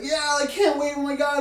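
put-on high-pitched, gushing kid voice; excited kid. Kid about to jump in the pool, bursting with excitement. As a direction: squeal it like a thrilled toddler. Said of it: "they're like".

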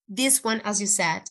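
ordinary delivery

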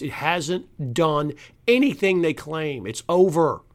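ordinary talk, nothing marked

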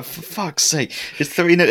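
high-pitched mock exasperation; wound-up exasperation. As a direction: imitate a fed-up player protesting from the background. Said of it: "getting more and more wound up".

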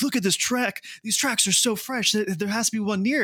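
high-pitched eager gushing; excited. Retold or imitated. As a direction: sound thrilled and sure. Friends excited over rhino footprint.

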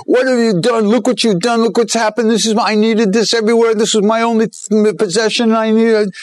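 high-pitched indignant complaint; retold yelling. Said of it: "He's yelling at Maharaj".

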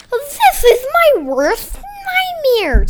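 high, slow cartoon voice; Taco voice. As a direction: draw it out in a squeaky kid voice. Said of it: "said Taco".

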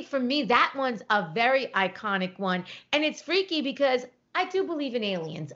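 ordinary talk, nothing marked

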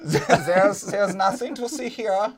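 mock-sinister German accent. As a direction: put on a hammy German accent with an innocent cover story. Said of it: "have a German accent".

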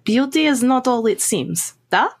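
put-on philosophical Russian-accented vampire. Confiding in Maria about appearances, Russian accent. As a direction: seek agreement in a Russian accent. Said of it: "German with a Russian accent".

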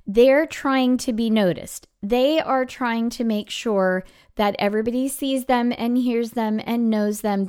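usual speaking voice

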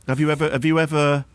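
high, quick needling lovey; a hint of a petty master; camp passive-aggressive voice. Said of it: "play the master role".

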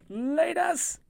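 shouting. Real, not acted. Loud high sign-off.

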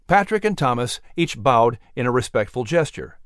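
ordinary delivery, nothing marked

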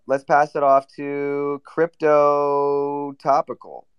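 slow host's hand-off, stretching "Crypto Topical" right out; drawn-out announcement. Real, not acted. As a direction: hold the vowels of "Crypto Topical" very long. Host calls up the next contestant.

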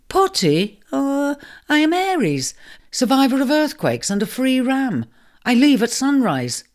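lightly voiced proud ram. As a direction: stand tall as a haughty ram.

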